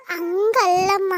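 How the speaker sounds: pleading with mother, words stretched very slowly; drawn-out protest. Acted. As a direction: drag the word out in protest.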